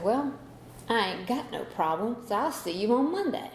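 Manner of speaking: a hint of smug defiance, tossed off; arrogant. Daughter lightly mimicking her arrogant reply to father, mock cocky. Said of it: "just so arrogant".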